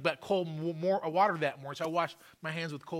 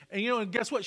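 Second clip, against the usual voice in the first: normal talking voice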